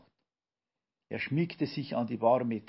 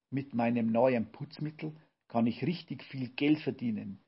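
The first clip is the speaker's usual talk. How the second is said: usual speaking voice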